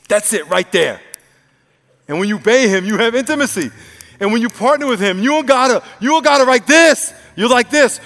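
high, loud, driving sermon voice; preaching at full force. Real, not acted. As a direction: drive it home to the congregation, loud and high.

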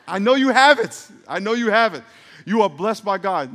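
loud, high insistence; forceful urging. Real, not acted. Preacher urging the congregation, loud and forceful. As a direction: fire them up.

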